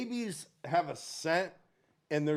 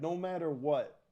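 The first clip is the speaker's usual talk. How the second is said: ordinary talk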